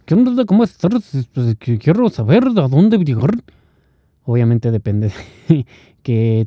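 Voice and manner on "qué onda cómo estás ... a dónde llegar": high, loud mock-Russian accent in Spanish. Russian asking a local for directions, put-on Russian accent. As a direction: imitate a Russian speaking Spanish, pitched up. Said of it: "imagínate igual, un ruso".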